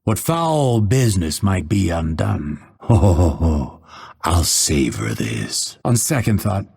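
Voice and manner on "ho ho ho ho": low, measured "ho ho" laugh; villainous chuckle. Acted. Assassin savoring a kill, gloating chuckle. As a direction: chuckle darkly over the kill.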